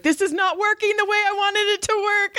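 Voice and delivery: high, put-on whine of a frustrated creep; mocking whiny guy. Mocking the pumpkin guy's whiny complaint, high whine. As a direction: sneer at him in a high mock voice. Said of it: "he was like".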